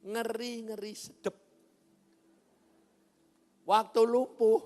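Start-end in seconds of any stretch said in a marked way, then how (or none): none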